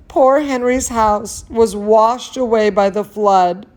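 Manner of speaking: ordinary delivery, nothing marked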